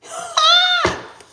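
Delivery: very high, loud squeal; high shriek. Acted. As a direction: let out a sudden shriek. Outburst while questioning the cards.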